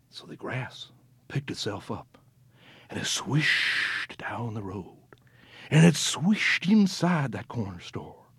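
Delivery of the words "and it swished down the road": ordinary delivery, nothing marked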